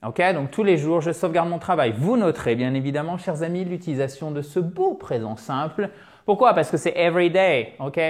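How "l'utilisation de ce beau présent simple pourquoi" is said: usual speaking voice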